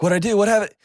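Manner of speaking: hammed-up anxious questioning; flustered husband. Acting out own reaction to crying wife.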